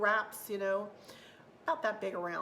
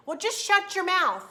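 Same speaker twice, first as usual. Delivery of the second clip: mocking critic; 10 semitones higher, 8 dB louder than usual. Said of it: "people say".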